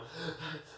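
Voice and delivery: acted-out choking cough; imitated choking cough. Patient shows friends how he choked on the tube, imitated choking cough. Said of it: "I'm choking".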